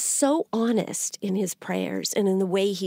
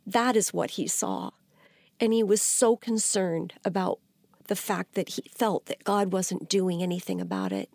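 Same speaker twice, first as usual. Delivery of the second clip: normal talking voice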